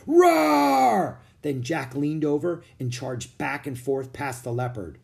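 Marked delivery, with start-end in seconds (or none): 0.1-1.2 louder high hammed-up roar; gorilla roar. Jack scaring off the leopard, louder hammed-up roar. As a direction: roar again even louder. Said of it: "he roared".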